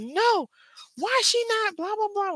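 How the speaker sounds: very high-pitched fussy mimicry; mock drama king. Roommate refusing to calm down, mock dramatic protest. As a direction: squeal his protest in an exaggerated high voice. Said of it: "you are such a drama king".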